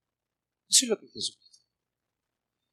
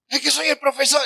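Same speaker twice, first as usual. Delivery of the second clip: high, loud self-important claim; arrogant teacher; 8 semitones higher, 6 dB louder, faster than usual. Pretend. Preacher mocking a professor who pulls rank, high and loud. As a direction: pull rank like a haughty professor.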